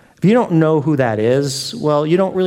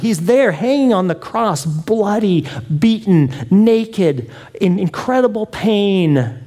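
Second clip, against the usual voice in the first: usual speaking voice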